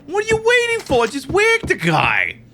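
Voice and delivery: high, thin mafia heavy yelling orders; squeaky mobster voice. Acted. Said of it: "I can't take you seriously".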